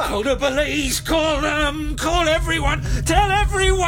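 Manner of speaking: high, loud yelling building to a long scream; hysterical screaming. Acted. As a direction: scream for help, escalating to full force. Screaming in a violent quarrel.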